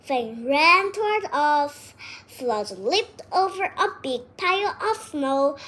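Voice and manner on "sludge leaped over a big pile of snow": plain reading voice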